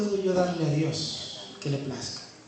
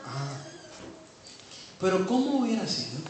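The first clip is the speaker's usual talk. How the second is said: ordinary talk, nothing marked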